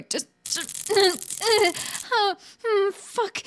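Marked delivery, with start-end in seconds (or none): 0.9-3.4 high, whimpering moan. Acted. Woman just tased by the man, high whimper. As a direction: whimper through the shock.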